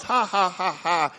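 a put-on mocking laugh as God; ha-ha-ha like a taunt; a light mock cackle. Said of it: "Then he laughs".

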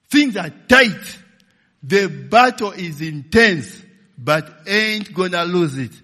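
loud, slow declaration of battle; loud, slow preaching at full force; preaching shout. Acted. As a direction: proclaim it like a war cry.

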